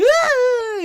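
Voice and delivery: high, loud hysterical crying ah; scream-cry in hysterics; hysterical wail. Retold or imitated. Clerk wails hysterically at the robber, a hammed-up high cry.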